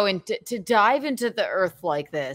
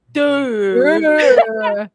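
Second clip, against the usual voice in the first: mock dumb dude; 2 semitones higher, 9 dB louder than usual. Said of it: "He does look like".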